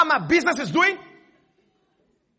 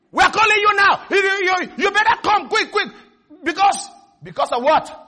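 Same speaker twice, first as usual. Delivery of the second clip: higher, louder pushy debt collector; demanding caller; 6 semitones higher, 4 dB louder than usual. Acted. Preacher voicing the debt collector's phone threat, loud and pushy.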